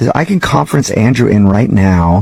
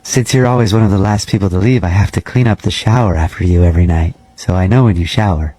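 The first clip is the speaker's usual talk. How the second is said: measured, slightly lowered janitor voice; lightly voiced creepy janitor; 4 semitones lower than usual. Night janitor cold-calling a gym member, lightly creepy voice. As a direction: play a polite, oddly intimate night janitor, understated.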